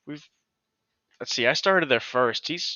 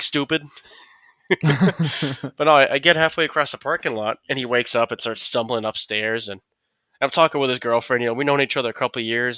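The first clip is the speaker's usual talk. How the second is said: ordinary talk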